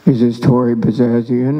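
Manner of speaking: lightly voiced low caller; caller on the phone. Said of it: "The guy calls and he goes".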